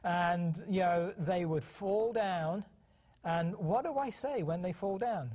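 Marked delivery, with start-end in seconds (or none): none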